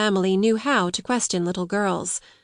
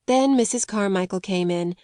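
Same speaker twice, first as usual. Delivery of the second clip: ordinary delivery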